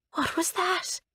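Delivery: high, frightened little question; a girl startled by a noise in the dark; scared question. Acted. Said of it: "looking scared".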